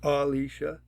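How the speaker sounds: Alicia with the opening "AH" stressed; first-syllable stress. Shown as a demonstration. Teacher demonstrating rule one, Alicia stressed on its first syllable. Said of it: "you'll hear how she pronounces Alicia".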